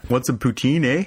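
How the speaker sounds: put-on playful Canadian accent on a silly question; mock Canadian. Host tries another Canadian line in a mock Canadian accent. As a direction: tease like a cartoon Canadian. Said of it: "what would be suitably Canadian?".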